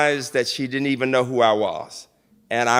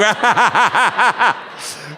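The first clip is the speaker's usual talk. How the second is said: big laugh; 6 semitones higher than usual. Real, not acted. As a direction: laugh in a long run of ha-ha-ha. Laughs at his own joke to audience.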